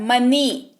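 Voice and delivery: "मनी" ending in a long falling ee; pronunciation demo. Shown as a demonstration. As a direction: say "मनी" with an ई sound at the end, never वाई. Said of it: "वाई नहीं उच्चारण हो रहा है".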